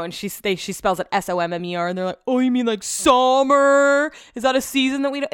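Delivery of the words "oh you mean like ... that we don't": high, louder sarcastic mimic of clueless people; mocking imitation. Mocking people who misread Summer's name, high sarcastic voice. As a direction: ham up their dumb confusion.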